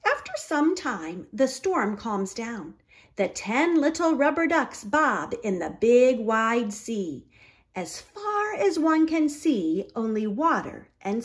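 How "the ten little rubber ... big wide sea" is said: ordinary delivery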